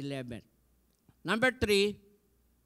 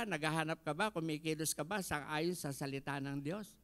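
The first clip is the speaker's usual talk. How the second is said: ordinary talk, nothing marked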